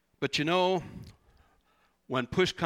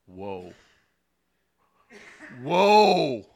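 awed whoa; 8 dB louder than usual. Retold or imitated. Peter realizing, retold.

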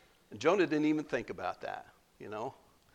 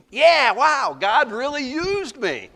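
much higher and louder, crowing; boastful cheer; 10 semitones higher, 7 dB louder, slower than usual. Acted. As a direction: crow over your own success. Preacher voices a boastful Jonah.

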